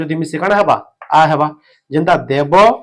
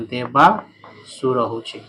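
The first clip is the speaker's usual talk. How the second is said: very slow, stretched ଦେବାସୁର ହଉଛେ, each syllable drawn out; ଦେବାସୁର said very slowly, syllable by syllable; slow teaching repeat; 4 semitones lower, 3 dB quieter, much slower than usual. Shown as a demonstration. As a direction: spell ଦେବାସୁର out slowly for the class. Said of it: "teacher repeats the word slowly".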